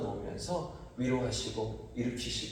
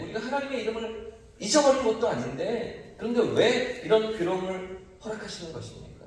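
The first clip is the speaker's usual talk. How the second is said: higher-pitched, pleading challenge to God; accusing protest; 6 semitones higher than usual. Acted. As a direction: argue your case upward, pitch raised on each complaint. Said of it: "이렇게 따지듯이 물으면서". (따지듯이 높은 목소리로)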